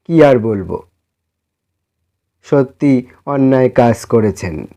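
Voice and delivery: ordinary delivery, nothing marked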